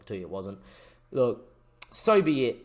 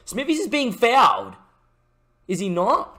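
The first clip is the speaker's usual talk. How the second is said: much higher, louder indignant cry; outraged cry; sudden protest; 10 semitones higher, 6 dB louder than usual. Real, not acted. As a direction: call out the foul in shock.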